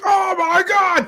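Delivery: high, loud mock shout of pain; pained yell. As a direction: yelp it like your finger just snapped. Drunk yells after finger broken.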